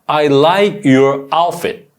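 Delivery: very slow, stress on like; slow model sentence. Shown as a demonstration. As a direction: model it slowly for students. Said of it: "한번 해볼까?". (천천히 또박또박 시범)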